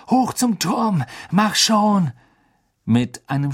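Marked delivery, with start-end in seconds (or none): 0.1-2.1 high, loud urging; urgent shout. Acted. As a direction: drive the beast on. Spurring the hippogriff. (antreibender Ruf)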